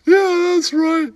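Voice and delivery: high, squeaky put-on character voice, with giggles; squeaky comic voice. Comic sidekick telling a bar story in a squeaky giggling voice. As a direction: play a giggling oddball sidekick.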